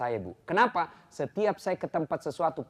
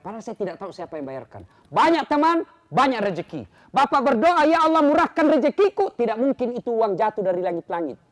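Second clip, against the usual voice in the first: loud, high sermon voice; full-force preaching; 9 semitones higher, 8 dB louder than usual. Acted. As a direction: hammer the point home. (khotbah keras penuh semangat)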